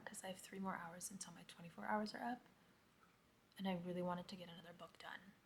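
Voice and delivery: fast hushed whisper; quick whisper to keep quiet. Real, not acted. Husband sleeping, early morning.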